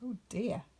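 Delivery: usual speaking voice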